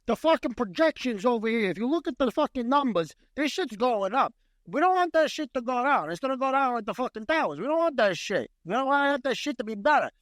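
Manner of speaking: high-pitched, hammed-up New York accent rant; New Yorker. New Yorker in a corporate setting, pushy New York accent. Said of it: "corporate setting with a fucking New Yorker".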